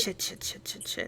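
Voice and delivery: breathy whispered cursing; whispered swearing. Real, not acted. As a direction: whisper the curses under your breath. Caught out, swearing under breath.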